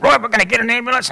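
quick, high, brisk order; urgent take-charge. Retold or imitated. Wife Joan taking over after the accident, quick and urgent. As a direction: snap into action like a wife in charge.

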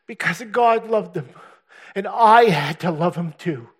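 impassioned preacher building to a cry; preaching at full force. Acted. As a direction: proclaim it like the Lord speaking. Voicing the Lord's message.

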